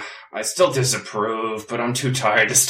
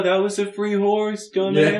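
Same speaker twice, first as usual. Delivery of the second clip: imitating the horse; 9 semitones higher than usual. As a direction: quote the horse up high. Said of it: "I will definitely take that".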